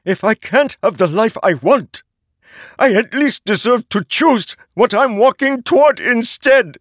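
very high, loud, desperate shrieking; shrieking at the herald who forged him; anguished shriek. A narrator's reading. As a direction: tear it out of him in despair. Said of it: "Szeth shrieked".

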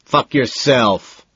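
furious slow roar. A narrator's reading. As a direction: bellow it, dragging each word. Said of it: "Price roars".

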